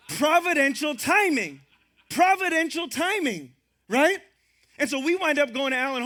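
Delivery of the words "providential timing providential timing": Providential timing, slow, loud and high; stress providential timing. Real, not acted. Preacher driving home his theme to the church, slowly. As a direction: lean on each word of Providential timing. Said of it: "God's providential timing".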